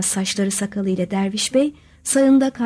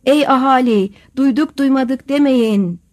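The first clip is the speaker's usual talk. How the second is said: loud slow crier call; town crier; 3 semitones higher, 5 dB louder than usual. Acted. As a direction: call out to the whole town, drawn out. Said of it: "belediye tellalının sokakları dolaşıp".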